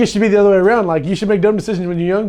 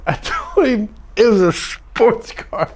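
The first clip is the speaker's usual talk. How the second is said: ordinary talk, nothing marked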